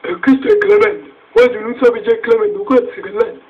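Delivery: excited. Acted. Excited intro of photo figures.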